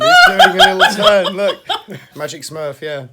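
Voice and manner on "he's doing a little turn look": much higher, louder delighted squeal; excited squeal. Real, not acted. Delighted by the Smurf toy.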